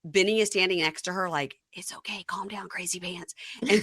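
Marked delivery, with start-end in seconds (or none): 1.7-3.4 hushed mock reassurance; very soft, near-voiceless calming; whispered soothing. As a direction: whisper it aside to someone hysterical.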